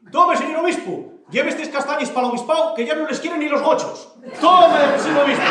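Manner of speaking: high, loud hostess pressing a gift; insistent offering. Acted. Manolona offers the bishop chestnuts, loudly insistent. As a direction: push it on him, loud and high.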